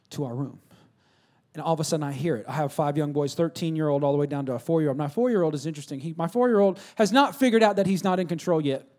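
normal talking voice

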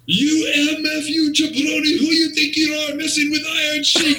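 high-pitched, hammed-up wrestler's tirade; mock yell, sustained high; Iron Sheik yelling. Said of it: "Sheik is yelling at him".

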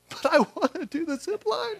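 mock tearful kid wanting the zip line; imitating crying child. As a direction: play the tearful son begging to go. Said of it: "He's, like, crying".

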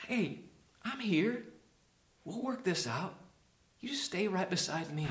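soft, gentle reassurance; tender Jesus. Acted. Jesus with his arm around us, tender and soft. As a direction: comfort someone close, softly. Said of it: "He is tender with us".

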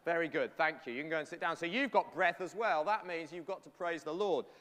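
usual speaking voice

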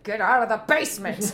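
half-laughing mock parental yell; parents' exasperated order. Parents shooing their kid from the basement, mock yell.

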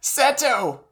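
put-on high, bewildered little kid voice; childlike voice. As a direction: whine it like a lost child. Imitating childish Mokuba.

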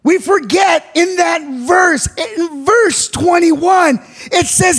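sermon cadence building to a cry; full-force preaching. Acted. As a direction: preach it to the whole church.

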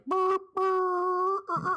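very high mimicked bleep tone; imitated censor bleep. Co-host imitating bleeped-out dirty words, high bleeping. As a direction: sing out a loud, high censor beep. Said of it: "when you bleeped everything out".

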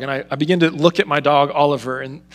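normal talking voice

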